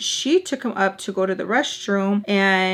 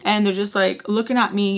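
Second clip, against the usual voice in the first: ordinary delivery, nothing marked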